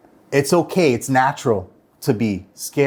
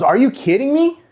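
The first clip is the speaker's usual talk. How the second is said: much higher, loud mock disbelief; incredulous mentor; 12 semitones higher, 4 dB louder than usual. Mentor scoffing at a gloomy young agent, incredulous. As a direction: toss it back like he can't believe it. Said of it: "And he goes".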